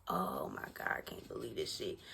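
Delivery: under-the-breath disbelief; hushed dismay over the lost money; hushed despair. Retold or imitated. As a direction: say it to yourself, barely voiced. Self despairing.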